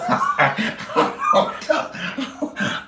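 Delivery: high breathy laughter breaking the quote; airy giggling. Real, not acted. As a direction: crack up mid-line.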